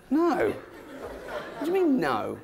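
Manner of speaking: high, slow outraged dog voice; a hint of a put-upon dog; indignant dog.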